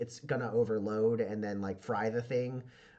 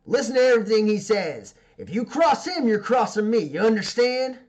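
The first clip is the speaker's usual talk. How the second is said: higher and louder, with a warning edge; threatening boss; 7 semitones higher, 9 dB louder than usual. Acted. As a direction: lay down the law to her crew. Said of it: "and says".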